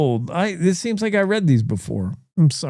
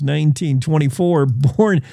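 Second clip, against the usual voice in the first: usual speaking voice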